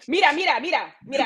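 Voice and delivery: playful fake Spanish calling out; hammy Spanish-accented mira; mock Spanish. That's not Spanish.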